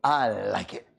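put-on low, grumbly old man. Old man on the plane approving, low. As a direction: drop the voice deep like a seventy-five-year-old. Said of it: "looks at me and goes".

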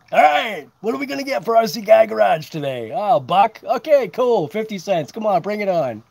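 loud, high hammed-up auctioneer calling bids; mock auctioneer. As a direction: play a pushy auctioneer taking bids. Mock auction of his own channel.

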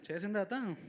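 ordinary delivery

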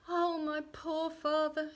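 high, slow wail; sobbing lament. A narrator's reading. Girl despairs for her poor father, acted sobbing. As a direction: draw it out in a high, held lament. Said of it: "she sobbed".

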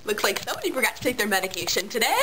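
usual speaking voice

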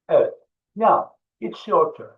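usual speaking voice

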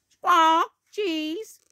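high squawking parrot voice; Polly. Acted. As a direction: squawk loudly, then parrot the words back high. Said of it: "Polly persists, repeats".

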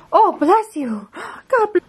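high, sing-song bless you. Real, not acted. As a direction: coo after the puppy sneezes. Dog sneezed, baby talk.

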